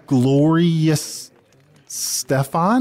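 stretched out syllables with a pause between; tentative, stretched and questioning; careful, drawn-out. Real, not acted. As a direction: sound out the name slowly, word by word. Sounding out a hard-to-say name.

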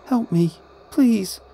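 put-on high-pitched pleading child's voice; small child begging for help; crying child. A narrator's reading. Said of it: "a child cried".

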